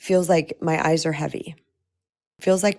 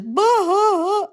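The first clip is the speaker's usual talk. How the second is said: loud, high imitation of sobbing; mock bawling wail; 14 semitones higher, 4 dB louder than usual. Showing listeners a sudden movie sob, mock bawling. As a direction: bawl it out as a comic sob. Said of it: "you just wanna sob your fool head off".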